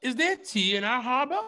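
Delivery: high, rising Baltimore caricature; mock Baltimore accent. Boston Tea Party joke, mock Baltimore accent. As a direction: ask it in an exaggerated Baltimore accent. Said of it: "Don't do Baltimore".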